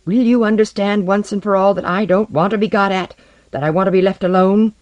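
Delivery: high, heated accusation; voice rising in anger. A narrator's reading. Man demanding to be left alone, exasperated. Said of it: "His voice had risen".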